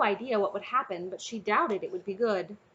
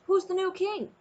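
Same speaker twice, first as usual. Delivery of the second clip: called out; 10 semitones higher, 3 dB louder than usual. Acted. Dragon calls over crowd.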